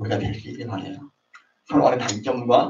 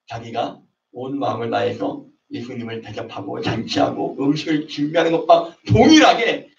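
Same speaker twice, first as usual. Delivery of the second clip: normal talking voice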